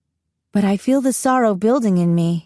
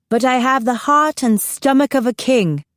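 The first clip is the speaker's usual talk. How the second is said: put-on higher, regal British accent; best British accent; 5 semitones higher than usual. A narrator's reading. Student playing Queen Elizabeth to her class, British accent. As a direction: address your lords in your best British accent. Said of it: "in my best British accent".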